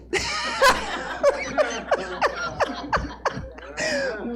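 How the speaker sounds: long, high laugh; real high-pitched laugh that runs on. Real, not acted. Speaker joking about naming next child, laughing. Laughs at her own joke.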